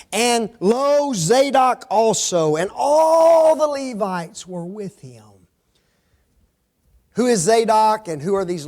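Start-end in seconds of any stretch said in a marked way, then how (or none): 0.1-5.2 slow, raised-pitch proclamation; drawn-out, elevated Bible-reading voice; grand scripture reading. Acted. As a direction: proclaim the verse slowly and high. Reads scripture aloud with flourish.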